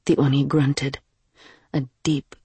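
usual speaking voice

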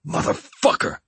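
high, hissed swearing, held in volume; hissed curse. A narrator's reading. Hostage curses after being clubbed on the head, hissed. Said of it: "I hissed".

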